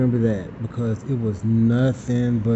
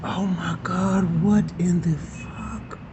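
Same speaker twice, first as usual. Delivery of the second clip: disgusted; 5 semitones higher, 5 dB quieter, slower than usual. Retold or imitated. Reacting to the smell.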